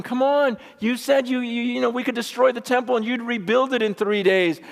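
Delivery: high-pitched, incredulous taunting; mocking. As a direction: imitate hecklers throwing his claim back at him. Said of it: "the people here are mocking him".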